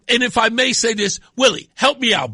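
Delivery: ordinary talk, nothing marked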